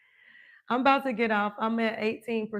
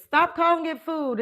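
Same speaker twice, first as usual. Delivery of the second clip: higher, louder insistence; emphatic; 6 semitones higher, 5 dB louder than usual. Shown as a demonstration. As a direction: drive it home. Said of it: "I teach people".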